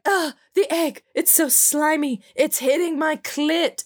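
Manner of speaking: put-on high, disgusted girlish whine; high squeamish priestess. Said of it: "She's like".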